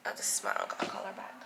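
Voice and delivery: soft whispered aside to herself. Real, not acted. Vlogger seeing her uncle's call, whispering to herself. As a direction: whisper it so the phone can't hear.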